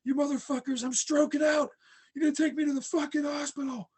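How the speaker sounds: high, loud raging scream at the paramedics; retold screaming. Man screaming at the EMTs to take him to hospital, enraged yelling. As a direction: scream it out like you're stroking out. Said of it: "I just start screaming at them".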